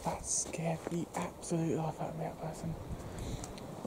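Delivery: slow shaken whisper; nervous night vlog whisper. Real, not acted. Just got scared.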